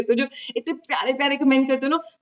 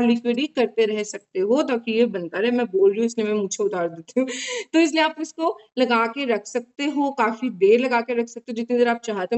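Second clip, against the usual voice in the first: stiff mask around mouth; restricted upper lip movement; speaking with face pack on. Real, not acted. Seen 1: Dried paste mask covers face and upper lip; lips stay bare.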